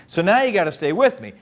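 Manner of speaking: usual speaking voice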